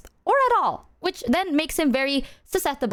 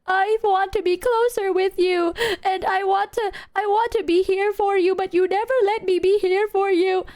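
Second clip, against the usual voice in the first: higher, pleading; retold crying plea; 10 semitones higher than usual. Retelling crying to dad, a higher pleading voice. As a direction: beg Dad like through tears. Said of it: "I'm talking full on tears".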